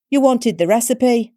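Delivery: breezy busybody granny; old crone voice. A narrator's reading. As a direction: crone handing a recipe to the contact.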